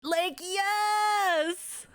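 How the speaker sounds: high, bright yes; excited cheer. Real, not acted. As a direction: cheer at the screen. Cheering at the video.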